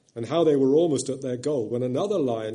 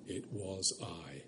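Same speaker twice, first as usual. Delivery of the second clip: a low, slow, gentle lion's voice; 5 semitones lower, 13 dB quieter, slower than usual. A narrator's reading. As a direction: rumble softly like a kind great lion. The Voice of Aslan.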